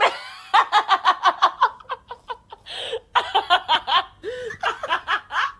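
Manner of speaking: high, loud helpless laughter; hysterical laugh. Real, not acted. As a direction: laugh until you can't breathe. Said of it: "laughing after impression".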